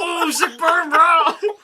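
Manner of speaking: high, laughing crowd reaction; mock hype. Co-host hyping Thea's comeback, high laughing jeer. Joining in on the burn.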